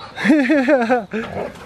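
real amused giggling; amused laugh. Real, not acted. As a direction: laugh along at the dogs. Laughing at the dogs.

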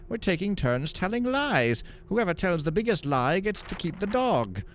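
put-on chirpy kid reply; boy's voice. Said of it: "The boy replied".

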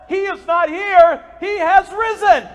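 slow, loud and high proclamation; triumphant preacher shout; proclaiming. Acted. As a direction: announce the resurrection with full force. Proclaims scripture.

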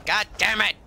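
loud frustrated curse; angry curse. Real, not acted. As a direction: snap out the curse. Fails to jump while sprinting.

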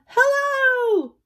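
loud high booming hello. Acted. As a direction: boom it out like a giant. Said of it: "enough to send them running".

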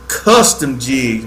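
slow, drawn-out Custom jigs; said slowly. Shown as a demonstration. Promoting sponsor's jigs to viewers, slowly. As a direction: stretch out Custom jigs so it sinks in. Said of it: "Let me say it slow".

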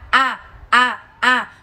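"A" with the mouth spread sideways, Vietnamese-style. Shown as a demonstration. Teacher contrasts Vietnamese with English, "a" said wide. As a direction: say "a" as the Vietnamese vowel, lips wide. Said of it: "làm âm a trong tiếng Việt là miệng các bạn di chuyển theo chiều ngang".

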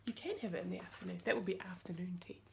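whispered aside. Real, not acted. Mum whispering an answer to her child's question.

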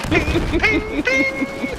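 high ping sounds. Shown as a demonstration. Friend mimicking hoped-for hit tones, high pinging. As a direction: sing a rising ping, ping, ping. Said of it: "different tones when you hit 'em".

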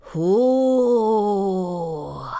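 long, low, eerie wail; icy monster call. A narrator's reading. Monster calling from under the bed, icy drawn-out wail. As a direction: draw it out like a ghostly call. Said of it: "an icy voice called".

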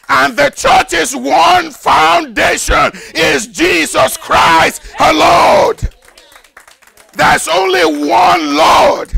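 preacher at full force; climactic preaching. Acted. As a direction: lift the crowd on the real hope. Preaching close on one church.

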